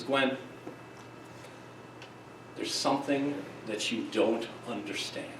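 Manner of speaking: soft, low voice with long pauses; calm pitching coach. Retold or imitated. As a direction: imitate a coach gently taking a player aside. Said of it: "puts her arm around her".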